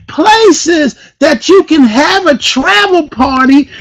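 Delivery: preacher-like hype, voice pushed up; hyped up. Real, not acted. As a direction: build to a big excited shout, stretching key words. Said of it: "Am I a little bit too hyped".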